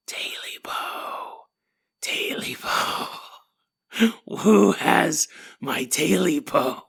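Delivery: slow, ghostly creature whisper; haunt him with long, drawn-out whispered words; eerie whisper. Acted. Creature calling to the hermit in his dark bedroom, eerie whisper.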